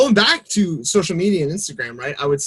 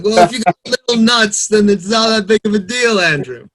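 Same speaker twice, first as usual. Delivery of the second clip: put-on higher, louder send-up of an English accent; mock English accent; 6 semitones higher, 6 dB louder than usual. Teasing his co-host about small nuts, mock English accent. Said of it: "impersonation of your English accent".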